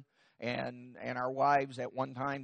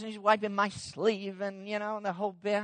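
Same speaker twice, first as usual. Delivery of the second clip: ordinary delivery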